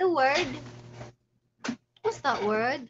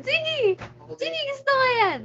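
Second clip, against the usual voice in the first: gleeful agreement; 11 semitones higher than usual. Real, not acted.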